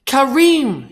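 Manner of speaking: high, loud call to another room; mother shouting for her husband. A narrator's reading. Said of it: "she shouted".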